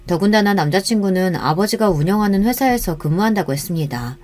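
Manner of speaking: ordinary delivery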